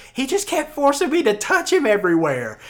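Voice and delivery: high, hammed-up girl's accusation; accusing girl. Girl accusing the dreamer to his girlfriend, high mock complaint. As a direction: put on a high, wailing accuser's voice. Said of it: "And the girl goes".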